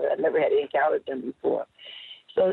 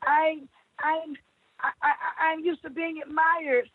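high pleading cry; 13 semitones higher, slower than usual. Acted. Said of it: "I screamed".

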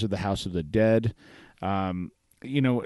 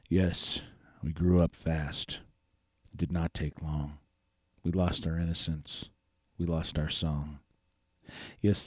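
poem reading; 3 semitones lower, 5 dB quieter than usual. Acted. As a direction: read the verse gently, pausing after each line. Reads a listener's poem aloud.

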